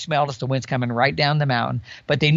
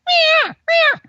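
high squealing cow elk call, much higher and louder; elk cow mew; 5 dB louder than usual. Retold or imitated. Hunter imitating cow elk calls to listeners, high and loud. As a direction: mimic a cow elk mewing up the slope. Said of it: "all of a sudden I can hear".

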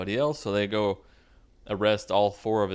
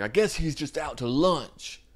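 mock mad; 7 semitones higher than usual. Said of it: "gets really mad at God".

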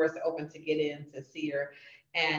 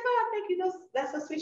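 gracious woman; 14 semitones higher than usual. Retold or imitated. Voicing the complimented woman.

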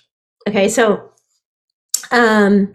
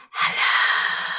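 stage whisper; 8 dB quieter than usual. Shown as a demonstration. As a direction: push the whisper out hard. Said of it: "One more time".

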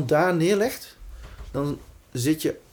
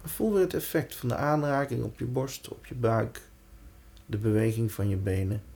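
guided meditation; 2 semitones lower, 4 dB quieter than usual. Acted. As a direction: lead them gently into calm. Guides attention through the body.

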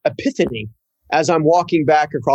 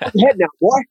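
higher-pitched imitation of an old North Carolina boss in a Southern accent; 8 semitones higher, faster than usual. Southern boss teasing young worker, imitated in a Southern accent. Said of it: "A good old Southern guy from North Carolina".

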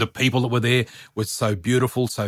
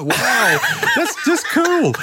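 laughing through it, much higher and slow; much slower than usual. Real, not acted. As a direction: let the laugh take the words. Laugh heard on these lines.

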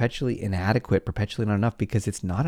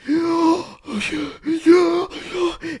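high, wailing mock gasp; a long theatrical gasp of grief; anguished gasp. Car owner mourning the key mark, wailing gasp. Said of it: "you're like".

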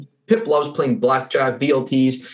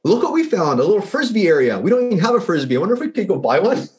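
higher-pitched mock wonder at a lucky find; delighted discovery; 6 semitones higher than usual. Traveler stumbling on a Frisbee area, delighted. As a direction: act out happily stumbling on a surprise.

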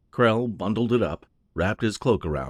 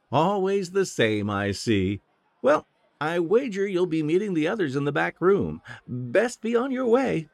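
coy barmaid teasing a shy lad; teasing innkeeper woman; 11 semitones higher than usual. Acted. Innkeeper teases Krell again.

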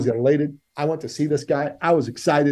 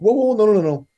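overwhelmed protest; 6 semitones higher, 6 dB louder than usual. Retold or imitated. Overwhelmed by coach's pressure.

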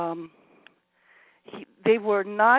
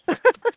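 high burst of laughter; 7 dB louder than usual. Real, not acted. Caller laughs at teasing remark.

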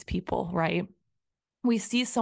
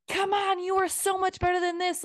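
much higher, pushy inner coach voice; high pep-talk push; 11 semitones higher than usual. Retold or imitated. Inner critic urging herself to try harder, high pushy voice. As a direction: egg yourself on in a high squeaky push.

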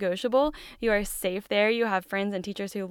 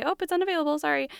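fake excuse; 8 semitones higher, faster than usual. Mock fake excuse to kid.

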